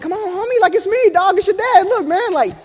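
high-pitched, exasperated coaxing; pleading dad. Retold or imitated. Dad to his crying baby, high comic pleading. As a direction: plead way up high, mock-desperate.

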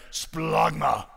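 "Splagma" drawn out like retching; exaggerated guttural "Splagma", as if gagging; retching pronunciation. Shown as a demonstration. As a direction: heave "Splagma" up from the gut. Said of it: "doesn't sound like you're retching".

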